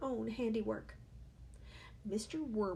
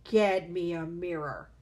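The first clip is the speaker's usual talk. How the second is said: slow, louder barked demand; yelling; 2 semitones lower, 7 dB louder than usual. A narrator's reading. Father yelling for a mirror, louder and slow. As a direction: demand it loudly. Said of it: "the father yelled".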